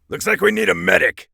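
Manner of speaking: high, loud shout across the battle; shouted call for a medic. Acted. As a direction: call out for help over the noise. Combat bark in game.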